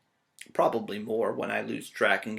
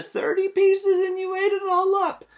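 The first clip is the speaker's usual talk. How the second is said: excited teasing; 8 dB louder than usual. Real, not acted. Teasing the class.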